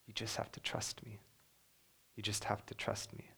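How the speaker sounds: soft unvoiced whisper of God; God whispering to Job from the whirlwind. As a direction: breathe the words barely aloud, tender and intimate. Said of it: "he whispered from a whirlwind".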